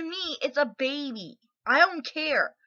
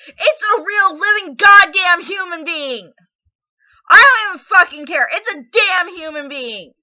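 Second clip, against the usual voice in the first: shouting through tears, much higher and louder; crying shout; 5 semitones higher, 10 dB louder than usual. Real, not acted. Grieving mother screaming at a commenter, sobbing.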